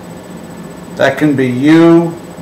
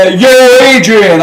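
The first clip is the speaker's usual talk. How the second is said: higher, louder Rocky "Yo, Adrian"; imitating Rocky; 6 semitones higher, 3 dB louder than usual. Teacher quoting Rocky to show the Latin Y. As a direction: toss off a Rocky line for fun. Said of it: "if I wanted to say".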